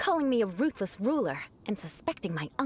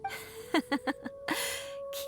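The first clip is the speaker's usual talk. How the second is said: soft amused chuckle; 7 semitones higher, 6 dB quieter than usual. Acted. Eula amused by Paimon.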